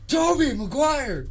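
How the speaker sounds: high, drawn-out shout; mock holler. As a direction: belt out the name like a silly announcement. Calls out the actor's name jokingly.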